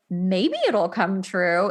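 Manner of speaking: usual speaking voice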